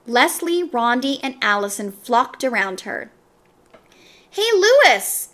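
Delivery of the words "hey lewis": a high call across the playground; shouting. A narrator's reading. Damien calls Lewis across the playground, shouting. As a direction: shout to Lewis to come play. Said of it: "Damien shouted".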